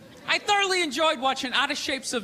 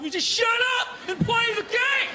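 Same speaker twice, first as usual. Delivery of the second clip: angry yell at TV; 10 semitones higher than usual. Retold or imitated. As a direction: yell at the screen in a rage. Angry fan yelling at TV.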